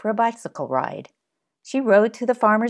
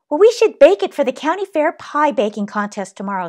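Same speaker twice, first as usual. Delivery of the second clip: put-on eager child; 7 semitones higher, 6 dB louder, faster than usual. Child urges mother to bake pie.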